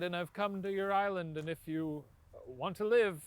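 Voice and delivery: normal talking voice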